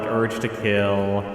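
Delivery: usual speaking voice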